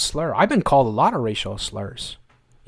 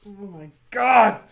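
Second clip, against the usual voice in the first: slow stunned disbelief; 5 semitones higher, slower than usual. Real, not acted. Disbelief at questionnaire.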